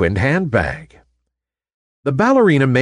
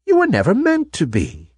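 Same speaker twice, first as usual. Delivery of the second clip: put-on a kind old lady revealing a secret; kindly old toy maker; 9 semitones higher than usual.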